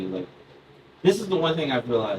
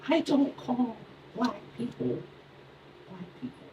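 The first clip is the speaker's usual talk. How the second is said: Tommy; 10 semitones higher, 6 dB quieter than usual. Retold or imitated. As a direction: imitate a first-grader confiding, haltingly. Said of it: "Tommy comes up to me and he's like".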